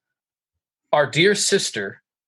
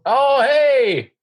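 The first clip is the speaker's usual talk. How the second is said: excited greeting; 12 semitones higher, 4 dB louder than usual. Real, not acted. Greeting someone arriving, calling out.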